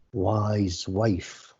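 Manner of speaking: usual speaking voice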